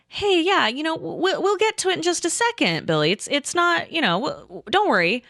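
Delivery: sweetly smooth it over, halting; really nice. Retold or imitated. Player soothing impatient friend at the table, really nice. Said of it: "like, really nice".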